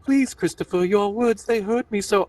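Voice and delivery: high, hurt little bear voice; wounded Pooh. Acted. Pooh pleading with Christopher Robin, high and hurt. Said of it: "I imagine he would try to be helpful".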